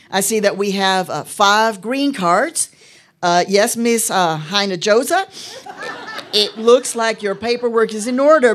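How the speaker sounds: put-on Texan accent. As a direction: play a tall Texan immigration officer. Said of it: "I'm gonna do an accent".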